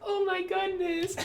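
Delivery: very high, drawn-out falsetto mimicry; Charlie. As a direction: mimic her in a slow, squeaky-high voice. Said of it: "And then Charlie's like".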